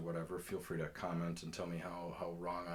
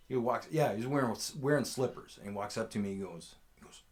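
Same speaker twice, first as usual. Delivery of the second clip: ordinary talk, nothing marked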